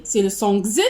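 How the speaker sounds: voiced "gz" sound for the letter X. Shown as a demonstration. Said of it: "selon la prononciation".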